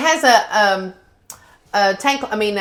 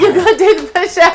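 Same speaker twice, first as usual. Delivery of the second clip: high, louder playful pitch; excited dog talk; 9 semitones higher, 6 dB louder than usual. Real, not acted. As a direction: hype up the dog.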